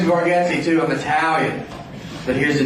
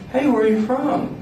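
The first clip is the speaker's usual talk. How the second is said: high-pitched friendly question; imitated higher-pitched inquiry; curious stranger; 10 semitones higher than usual. Strangers asking about his accent.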